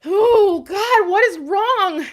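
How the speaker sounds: high, loud tearful wail; real crying, voice shooting up. Real, not acted. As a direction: apologise as the tears burst out. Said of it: "Oh, I'm about to cry".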